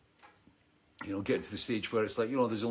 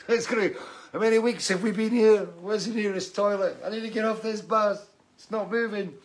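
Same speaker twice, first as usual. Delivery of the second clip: laughing mock complaint, pitched up and louder; laughing, higher, louder; 10 semitones higher, 5 dB louder than usual. Musician imagining stuck tour bus, laughing mock whine.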